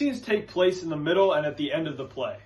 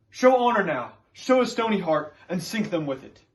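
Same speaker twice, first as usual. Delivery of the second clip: impassioned plea, louder and higher; John Proctor; 5 semitones higher, 6 dB louder than usual. Acted. Accused Puritan pleads to keep his name, impassioned. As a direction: plead fervently, driving the key phrases up. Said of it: "the first one is John Proctor".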